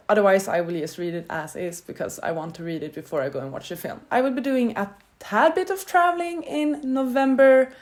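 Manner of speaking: normal talking voice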